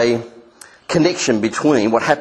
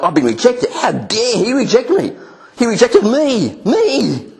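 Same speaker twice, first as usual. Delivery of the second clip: indignant outrage; 11 semitones higher than usual. Retold or imitated. Preacher voicing the rejected, crying out in outraged indignation. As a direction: play the rejected one, furious and hurt that anyone would dare. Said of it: "so they're angry about it".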